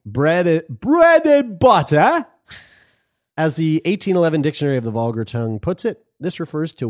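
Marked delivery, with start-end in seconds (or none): 0.0-2.3 high, slow, drawn-out English bread and butter; exaggerated English accent, stretched out; mock English accent. Shown as a demonstration. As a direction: ham up a posh English accent. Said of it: "best English accent continued".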